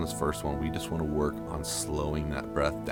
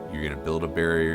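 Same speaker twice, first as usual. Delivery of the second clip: ordinary talk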